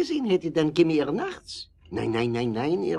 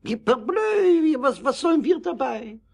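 startled exclamation; 9 semitones higher than usual. Acted. Startled Frenchman at night request.

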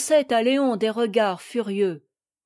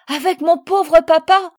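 put-on high, loud, impassioned child's exclamation; crying out; 6 semitones higher, 9 dB louder than usual. A narrator's reading. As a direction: burst out loudly, pitched high. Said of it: "s'écria Marguerite". (s'écrier d'une voix aiguë et forte)

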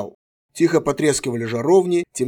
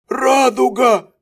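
hoarse cry; 10 semitones higher, 6 dB louder, much slower than usual. A narrator's reading. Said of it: "прохрипел было пленник".

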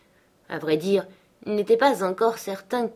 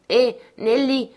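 raised, slow hail to the servant; calling out; 6 semitones higher, 6 dB louder than usual. Acted. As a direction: call her name louder and higher, drawn out. Heathcliff calls out to Nelly.

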